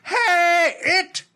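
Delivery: high, hammed-up Irish cop bark; mock Irish cop yelling at kids; old Irish cop voice. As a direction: play a gruff old Irish beat cop. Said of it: "He does, like, this old Irish cop voice".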